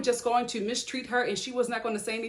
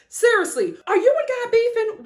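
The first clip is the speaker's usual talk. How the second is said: higher and louder, disbelieving; incredulous outburst; 11 semitones higher, 5 dB louder than usual. Real, not acted. Vlogger asking the couple if they're feuding with God, incredulous. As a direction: shout it in disbelief.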